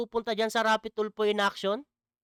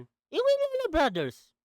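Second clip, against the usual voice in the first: pitched up, mocking the accusers; mock sarcastic answer; 7 semitones higher than usual. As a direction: sneer out the name like it's obvious. Mocking answer to own question. (pakutyang sagot, mas mataas ang boses)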